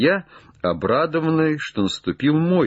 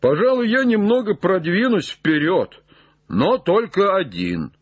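high, lightly hollered offer; calling down; 9 semitones higher than usual. Acted. Jupiter offers to go on alone, high call. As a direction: call down from the branch.

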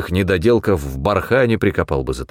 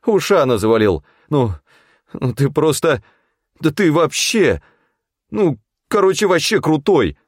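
higher-pitched awed crony voice; admiring sidekick; 8 semitones higher than usual. A narrator's reading. Федька praising the hunter's kill, high and admiring. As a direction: gush in a raised voice. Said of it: "не отставал от него Федька".

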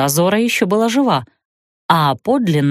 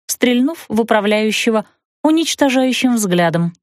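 plain reading voice

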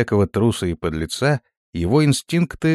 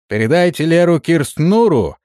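raised call from the coach; shouting; 6 semitones higher, 4 dB louder than usual. Acted. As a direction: call out to the camp. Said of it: "крикнул рейдер на козлах".

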